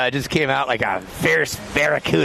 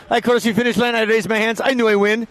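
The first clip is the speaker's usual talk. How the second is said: cocky mock French accent, pitched higher; Edgar Groperon French accent; 6 semitones higher than usual. Commentator imitating a French mogul champion's interview, cocky mock French accent. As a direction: ham up a proud French champion's broken English. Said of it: "He goes".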